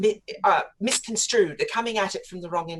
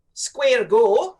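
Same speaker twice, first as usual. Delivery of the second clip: put-on "square go" in a light Scottish accent, louder and higher; Scottish phrase; 6 semitones higher, 8 dB louder than usual. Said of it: "as the Scots say".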